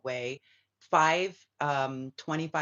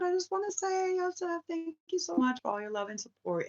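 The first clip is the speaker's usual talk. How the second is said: much higher, sweet little voice; cutesy thanks. Real, not acted. Thanking Yosef for his support, high cutesy voice. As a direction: thank him in a tiny high voice.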